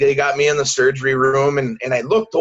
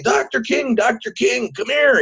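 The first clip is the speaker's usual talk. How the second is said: retold yell; 8 semitones higher than usual. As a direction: imitate calling out for the doctor without raising volume. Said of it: "I'm yelling his name".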